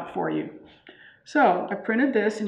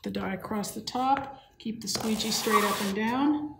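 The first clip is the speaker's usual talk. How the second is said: whispering; 5 dB quieter than usual. Real, not acted. Whispers while printing.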